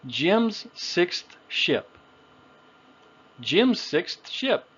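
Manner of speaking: "Jim's sixth ship" word by word; short I sound. Shown as a demonstration. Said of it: "how we can make that short I sound".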